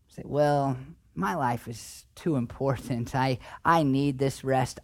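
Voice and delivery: mock-smug excuse to Jesus; mock self-important.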